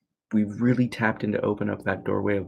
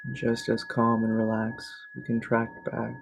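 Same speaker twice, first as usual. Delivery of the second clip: guided meditation; 3 semitones higher than usual. Real, not acted. Teacher shifting attention to navel and breath, calm meditation voice. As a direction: guide them calmly through each breath. Leads evening relaxation meditation.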